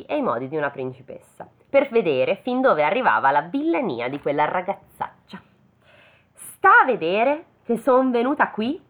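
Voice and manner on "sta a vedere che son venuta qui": higher, haughty jeer; bratty mock scorn; arrogant, rude. A narrator's reading. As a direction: sneer with full-blown arrogance. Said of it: "quella maleducata piena di boria".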